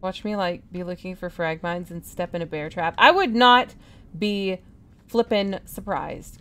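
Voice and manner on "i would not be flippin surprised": emphatic outburst. Real, not acted. Exasperated at bear trap risk.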